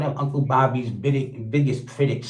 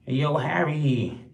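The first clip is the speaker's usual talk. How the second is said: normal talking voice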